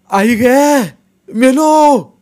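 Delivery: very high, loud scream of terror; terrified scream. A narrator's reading. Tuka screaming in terror at the ghosts in the stream. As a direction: scream as if about to die. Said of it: "तो मोठ्यानं ओरडला".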